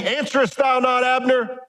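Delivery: pitched high, calling across a valley. Acted. David calling across the hill to Abner, high call. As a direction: call out to someone far off.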